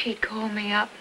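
hushed wistful whisper. Acted. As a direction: breathe it under your breath. Longing aside during the party.

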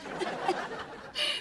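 breathy high giggle; giggly laugh. Real, not acted. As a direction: laugh in delight. Old school gossip confirmed.

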